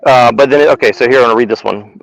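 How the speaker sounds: usual speaking voice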